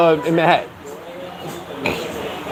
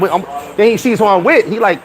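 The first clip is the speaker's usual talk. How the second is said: ordinary talk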